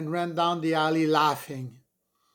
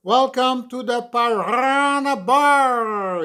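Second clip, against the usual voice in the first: slow booming MC welcome, much higher and louder; MC shouting; 8 semitones higher, 9 dB louder, slower than usual. Acted. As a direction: roll the R like a hyped emcee. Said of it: "he shouted, extending the R sound".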